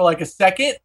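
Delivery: ordinary talk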